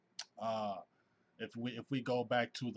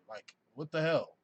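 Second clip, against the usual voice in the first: ordinary talk, nothing marked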